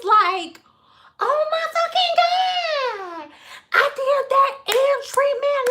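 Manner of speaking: put-on shrieking, stretched-out Valley girl squeal. Client learning of eggs in her hair, mock Valley girl shrieking. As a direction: squeal it sky-high, dragging out each exclamation. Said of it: "this the same girl that's Valley girl".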